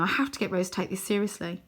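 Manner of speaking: usual speaking voice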